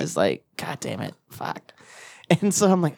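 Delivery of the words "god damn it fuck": much softer, lower, muttered; defeated mutter. Retold or imitated. Hungover in the work bathroom, cursing softly in despair. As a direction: curse under your breath in despair.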